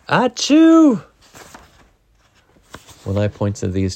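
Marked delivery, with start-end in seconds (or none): 0.1-1.0 loud, high acted sneeze; big sneeze. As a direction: let the achoo explode. Elephant sneezes in story.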